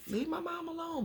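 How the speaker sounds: put-on high, soft little girl's plea; mock crying. Little girl defending her mom, high mock crying. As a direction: imitate a crying child, high and softened. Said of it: "And she's crying".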